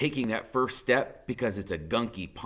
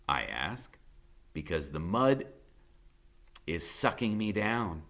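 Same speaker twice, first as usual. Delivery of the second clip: ordinary delivery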